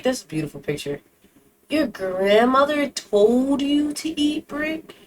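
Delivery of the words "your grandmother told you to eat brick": incredulous. Real, not acted. Incredulous repeat, slower.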